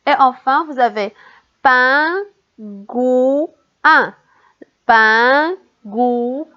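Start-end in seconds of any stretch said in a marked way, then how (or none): 1.6-3.5 "pingouin" slowly separated, pin, gou, in; split into syllables. Shown as a demonstration. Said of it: "Dans quelle syllabe on entend le son". 4.8-6.5 "pingouin" slowly separated, pin, gou, in; split into syllables. Shown as a demonstration. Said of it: "Dans quelle syllabe on entend le son".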